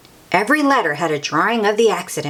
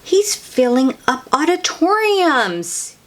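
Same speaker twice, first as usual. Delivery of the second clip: usual speaking voice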